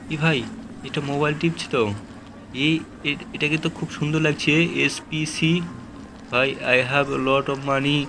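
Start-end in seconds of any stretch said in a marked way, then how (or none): 6.3-8.0 slow, drawn-out boastful line; slow character voice. Pretend. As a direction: drag it out like a bragging stranger. NPC line voiced slowly. (ধনী চরিত্রের ধীর টেনে বলা কণ্ঠ)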